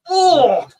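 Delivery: loud high disgusted Oh!; a boy's shocked cry of disgust; sudden gagging yelp from the old boy; retching oh. Retold or imitated. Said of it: "He goes".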